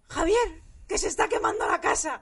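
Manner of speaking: high, loud alarmed cry of fire; alarmed shout. Retold or imitated. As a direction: burst in yelling that the house is burning. Sister bursts in, fire.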